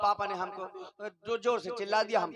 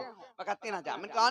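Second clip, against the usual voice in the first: normal talking voice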